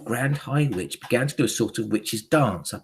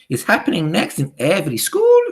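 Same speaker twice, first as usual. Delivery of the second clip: loud, high witch question; shouting, accented witch; Grand High Witch; 7 semitones higher, 7 dB louder than usual. A narrator's reading. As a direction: demand it of the crowd, rising at the end. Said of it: "shouted the Grand High Witch".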